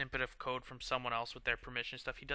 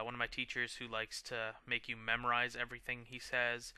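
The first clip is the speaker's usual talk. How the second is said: ordinary talk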